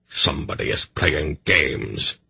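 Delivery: plain reading voice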